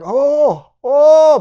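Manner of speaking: high, loud, held "oh"s; imitated ecstatic cries. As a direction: whoop each "oh" like someone overwhelmed. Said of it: "just going like".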